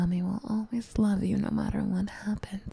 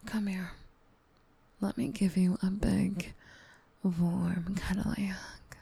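ASMR whisper. Acted. Mommy comforting her upset gamer sweetie, tender whisper. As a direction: whisper close to the mic like a soothing mommy.

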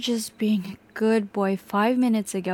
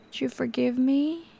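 usual speaking voice

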